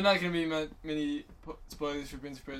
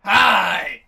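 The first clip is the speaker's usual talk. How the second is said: shout; 5 semitones higher, 19 dB louder than usual. Acted. Sheepdog greeting shout.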